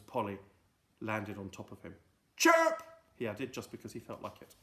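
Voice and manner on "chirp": high loud chirp; bird chirp. Acted. Friday chirping just for fun.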